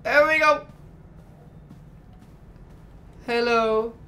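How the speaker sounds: high, bright put-on greeting; voicing the android as it starts; playful character voice. Gamer voicing his android character, high and loud.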